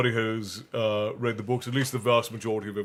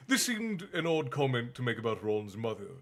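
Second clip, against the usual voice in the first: ordinary delivery, nothing marked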